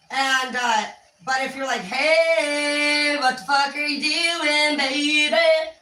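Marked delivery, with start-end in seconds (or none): 1.8-5.7 high, drawn-out singsong greeting; obviously happy. Shown as a demonstration. Demo of a welcoming tone to a partner, happy. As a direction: stretch the vowels into a happy singsong. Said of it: "That's obviously happy. Same words, different tone".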